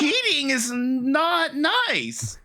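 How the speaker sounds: high, drawn-out mock-indignant kid; imitating indignant child. Uncle imitating his five-year-old nephew, indignant and confused. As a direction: do a small boy's slow, aggrieved protest. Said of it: "so indignant and confused".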